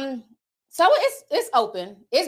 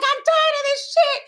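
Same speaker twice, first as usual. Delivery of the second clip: high, fed-up, a touch louder; fed-up outburst; 4 dB louder than usual. Retold or imitated. Telling Maddie she's done with Instagram, exasperated squeal. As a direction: throw your hands up, done with it all. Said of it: "And I'm like".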